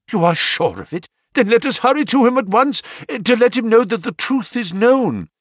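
high, fast, loud urging; urgent excitement. Acted. Banker eager to hurry to his wronged son, high and fast. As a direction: rush to go at once.

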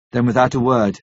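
ordinary delivery

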